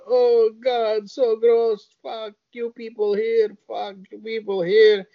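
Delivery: high, disgusted woman's voice; hammed-up disgusted woman, pitched high; high mock disgusted woman. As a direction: spit it out high and revolted. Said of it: "she's like".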